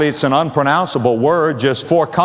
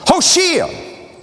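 "Hoshea" sung out much higher, drawn into a falling tune; lifted repeat; 12 semitones higher than usual. Shown as a demonstration. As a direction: ring out "Hoshea" high, then let it fall. Repeating the name for emphasis.

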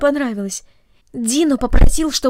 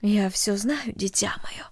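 put-on hushed, soft whisper to a child; quiet whisper; 6 dB quieter, slower than usual. Mother calming the frightened girl in a soft whisper. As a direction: soothe her in a whisper. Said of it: "тихо сказала мать".

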